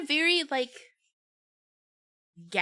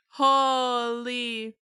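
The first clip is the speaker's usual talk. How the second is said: amazed; 3 semitones higher, 5 dB louder than usual. Real, not acted. Louder repeat of holy.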